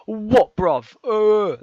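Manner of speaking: mocking London chav, high and loud; London chav accent. Mocking London chav kids' tough-guy voice, loud. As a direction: ham up a tough London hooligan. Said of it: "And they're like".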